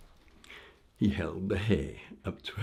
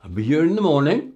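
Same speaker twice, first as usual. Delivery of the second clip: cheeky call; 7 semitones higher, 12 dB louder, faster than usual. Acted. Jesper loud, fast reply.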